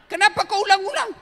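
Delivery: high, fast, put-on annoyed voice; a hammed-up irritated wife asking why they keep repeating it; mock irritated wife. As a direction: snap back fast and high like a fed-up wife. Mock annoyed reply to audience.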